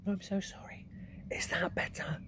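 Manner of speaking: soft whispered apology; girl trying to speak quietly to the giant. Acted. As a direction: whisper it right into his ear. Sophie whispers into ear.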